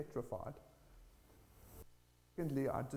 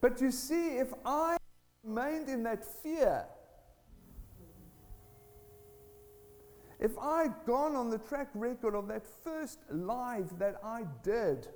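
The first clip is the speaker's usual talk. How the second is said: high, loud, measured pulpit emphasis; forceful preaching; 9 semitones higher, 5 dB louder than usual. Real, not acted. Preacher warning church against remaining in fear, forceful preaching. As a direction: drive the point home with raised voice. Drives home the sermon point.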